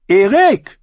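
high, loud woman's voice cutting in; woman's call. A narrator's reading. Woman interrupts the chanting men, calling loudly. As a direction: call out his name over the crowd. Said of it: "unterbrach ihn die Stimme einer Frau".

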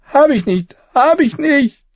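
high tearful sobbing denial; crying. Acted. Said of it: "weinte er".

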